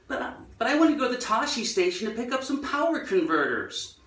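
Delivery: high, whiny Luke Skywalker American accent, imitated. Luke asking Uncle Owen to go to Tashi station, American accent. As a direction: mimic Luke's American whine to Uncle Owen. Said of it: "I thought that was some fictional accent".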